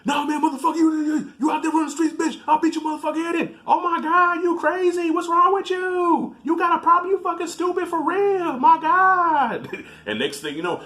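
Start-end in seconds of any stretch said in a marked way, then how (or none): 0.0-3.6 high-pitched sputtering mock threats; hammed-up furious yelling, words tumbling out; arguing boyfriend. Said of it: "they start arguing again". 3.7-9.7 very high, loud mock outrage; hammed-up hysterical shouting; arguing girlfriend. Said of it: "they start arguing again".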